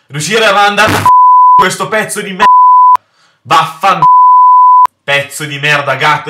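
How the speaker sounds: angry cursing. Real, not acted. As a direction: curse the cat out loud. Raging at game cat. (imprecazioni rabbiose)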